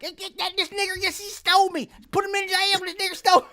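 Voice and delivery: a high puppet shouting to the cop. Retold or imitated. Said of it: "And he's like".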